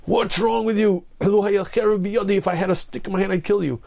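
hammed-up furious rider yelling at his donkey; a lightly voiced angry shout; mock angry scream. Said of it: "he screams at this donkey".

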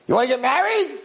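master's shouted proposal; exclaimed mock offer; high-pitched call. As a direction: pitch it right up, calling to her.

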